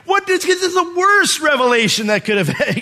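very high, loud mock dismay; horrified shout. Brothers thinking over Joseph's terrible revelation, horrified shout. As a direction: shout it in hammed-up horror.

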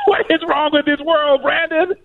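high-pitched, laughing disbelief; laughing exasperation. Real, not acted. Guest to host over an absurd video, laughing exasperation. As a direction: wail it through real laughter.

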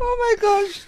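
very high, laughter-squeezed voice; helpless amusement. Real, not acted. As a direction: gasp it out after a laughing fit. Recovering from laughing fit.

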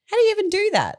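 high astonished question; disbelieving, pitch shooting up; amazed. Real, not acted. Amazed at triple medal feat.

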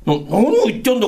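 ordinary talk

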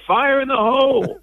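put-on high laughing kid's yell; imitating child yelling. Host laughs while imitating boy's alarm cry. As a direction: yell it like a little kid, laughing. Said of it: "The only way he knew to say it".

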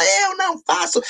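retorted huffily, stretching each word; a wife's mock-offended denial, high and slow; indignant denial. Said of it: "ficou tão brava".

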